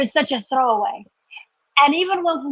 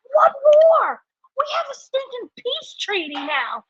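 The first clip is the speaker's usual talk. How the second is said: incredulous; 9 semitones higher, slower than usual. Real, not acted. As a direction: push the repeat up in disbelief. Indignant repeated question.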